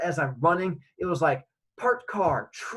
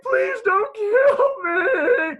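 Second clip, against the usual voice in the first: long, high wailing scream; screamed plea; 8 dB louder, much slower than usual. Retold or imitated. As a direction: beg for your life, shrieking. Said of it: "I'm screaming at him as best I can".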